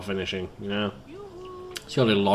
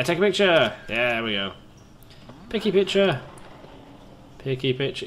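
ordinary talk, nothing marked

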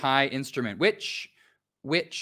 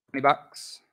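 American twenty bucks. Shown as a demonstration. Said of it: "That's how Americans say it".

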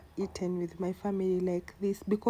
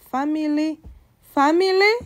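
Family repeated, each higher and louder; rising emphasis; 7 semitones higher, 9 dB louder than usual. Shown as a demonstration. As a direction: build family up to a peak. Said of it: "repeats family building".

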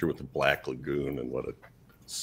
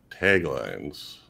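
segment intro; 4 semitones lower, much slower than usual. Acted. Introducing the show's recurring segment.

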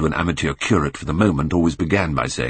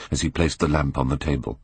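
usual speaking voice

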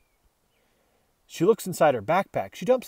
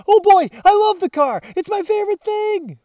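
much higher, louder squeal; thrilled dog; 14 semitones higher, 10 dB louder than usual. Acted. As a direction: yelp with joy over the car. Dog thrilled about car.

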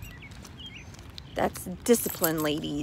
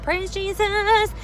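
higher, loud, slow drawn-out praise; joyful exclamation; 11 semitones higher, 9 dB louder, much slower than usual. Real, not acted. Mom celebrating the fixed air, slow joyful cry. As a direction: stretch it out in relief.

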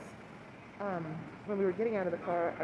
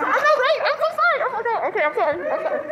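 high, loud, laughing apologies; giddy; 13 dB louder than usual. Real, not acted. Starstruck vlogger apologizing to viewers, high and loud with laughter. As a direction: gush it out, can't contain herself.

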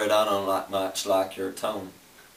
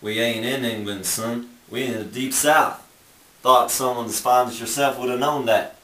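put-on crazy Southern accent; 3 semitones higher than usual. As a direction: play the old-time Southern barkeep, lightly. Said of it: "That's a crazy accent".

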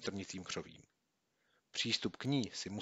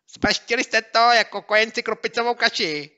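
much higher, louder, gleeful; gloating taunt; 14 semitones higher, 14 dB louder than usual. Acted. Bully gloating over the tricked boys, gleeful. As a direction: gloat at them. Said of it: "dával průchod své radosti".

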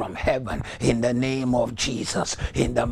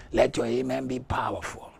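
ordinary delivery

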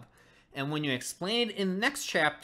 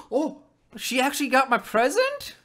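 happy grandfather; 9 semitones higher, 6 dB louder than usual. Retold or imitated. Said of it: "he's all happy then".

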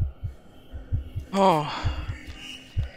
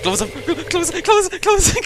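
panicked; 13 semitones higher, 4 dB louder than usual. Real, not acted. Frantically closing a door.

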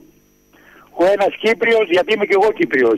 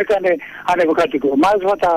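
ordinary talk, nothing marked

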